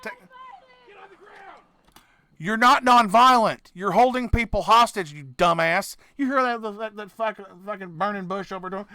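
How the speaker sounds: ordinary talk, nothing marked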